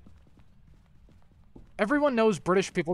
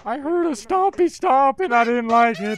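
being chased; 4 semitones higher, 7 dB louder than usual. Real, not acted. Scared by monster footsteps.

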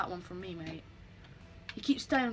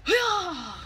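louder and higher, a victory whoop; triumphant cheer; 7 semitones higher, 6 dB louder than usual. Real, not acted. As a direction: shout it out after the save. Celebrating a save in game.

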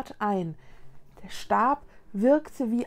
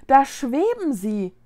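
higher, louder, drawn-out exclamation; excited discovery; 4 semitones higher, 6 dB louder than usual. Acted. Child shows friends the hovering ghosts, excited. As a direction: point them out to your friends, slowly.

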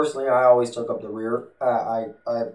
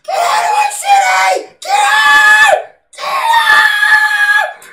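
very high, loud shrieking yell; piercing mock shriek at passersby; imitating screaming woman; 13 dB louder, much slower than usual. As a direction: screech it like the hysterical lady. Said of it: "she's still screaming".

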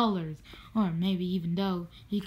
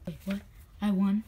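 ordinary talk, nothing marked

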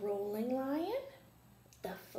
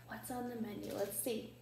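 usual speaking voice

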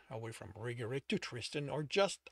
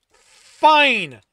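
loud, higher single bark; curt angry fine; 9 semitones higher, 20 dB louder than usual. A narrator's reading. Alex cuts the fight off, curt and loud. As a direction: slam the word down.

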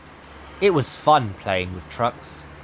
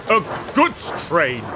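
a grumble pushed a little higher; grumbling, rising; 4 semitones higher than usual. A narrator's reading. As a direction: let the grumble climb at the end. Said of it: "he grumbled".

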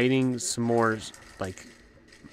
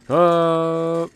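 1 surprised oh; 5 semitones higher, 7 dB louder than usual. Real, not acted. Sudden enemy reaction.

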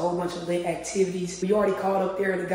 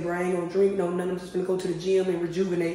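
normal talking voice

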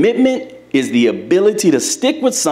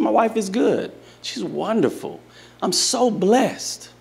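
doting husband; 5 semitones higher, 5 dB quieter than usual. Retold or imitated. Coach telling the office ladies about his wife, soft and doting. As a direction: gush softly about your wife.

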